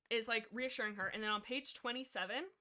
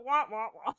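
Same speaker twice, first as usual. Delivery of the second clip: mock wailing; 9 dB louder than usual. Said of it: "so we go".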